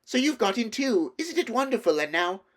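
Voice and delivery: high, loud and thrilled; bubbling over with joy; Lucy thrilled to see him; excited. A narrator's reading. Lucy overjoyed meeting Edmund.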